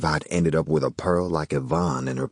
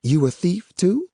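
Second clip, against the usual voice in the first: serious, higher woman's voice; 12 semitones higher, 3 dB louder than usual. A narrator's reading. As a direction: ask it seriously. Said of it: "in a serious tone".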